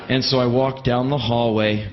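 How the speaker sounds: ordinary talk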